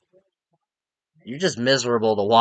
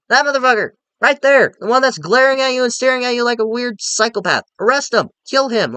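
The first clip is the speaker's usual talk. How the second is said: higher, louder mock outrage at the screen; yelling at the screen; 10 semitones higher, 4 dB louder than usual. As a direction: point and shout him out like a crowd.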